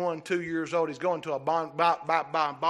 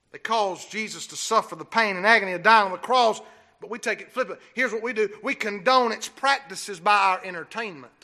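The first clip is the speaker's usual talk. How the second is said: ordinary talk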